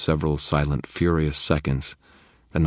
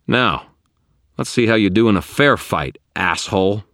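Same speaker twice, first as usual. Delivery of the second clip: loud, raised taunt; defiant challenge; 6 semitones higher, 9 dB louder than usual. A narrator's reading. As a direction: dare the monster to fight. Wizard challenges the bound monster.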